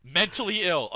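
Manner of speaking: high loud laughing. Real, not acted. Laughing embarrassed.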